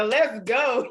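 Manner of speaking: pumped-up call to get moving; hyped. Acted. Repeating the reaction to God's confirmation, hyped. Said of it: "I'm sorry, I'm getting hyped".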